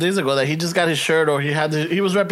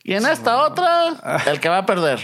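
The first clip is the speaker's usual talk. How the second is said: lucha libre announcer. Pretend. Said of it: "And then I'll be like".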